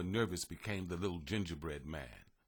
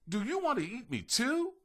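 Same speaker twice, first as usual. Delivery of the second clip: worried question. A narrator's reading. Gingerbread man asks butterfly nervously.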